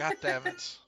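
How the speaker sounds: loud, high exasperated curse. Real, not acted. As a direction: blurt it out, laughing in annoyance. Laughing frustration at song.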